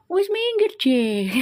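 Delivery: high-pitched, mock-grumbling Javanese "wis minggir"; mock Javanese grumble. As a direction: nag like an annoyed Javanese boyfriend, half in jest. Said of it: "Ngomel-ngomel kayak".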